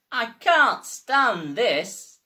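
slow, loud, drawn-out grumble; weary complaint. Acted. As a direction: drag every word out, fed up. Mister Bear complains, fed up.

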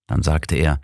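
plain reading voice

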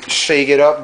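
ordinary delivery

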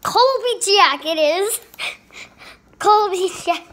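loud, slow, thrilled shout; excited. Real, not acted. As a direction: cheer the name out. Delighted with the new name.

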